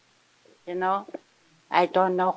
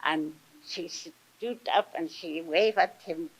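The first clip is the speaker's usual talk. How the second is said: ordinary delivery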